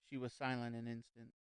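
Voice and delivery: ordinary delivery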